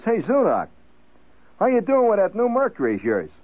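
the chemist greets Zudak at the bar; chemical worker. Retold or imitated.

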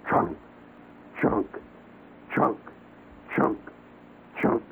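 slow separate chunk sounds, like an axe biting wood; wood-chop insect noise. Retold or imitated. Telling a friend about the jungle insect, mimicking axe chops. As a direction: knock out each chunk like a woodsman's axe. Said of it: "You hear the sound of that".

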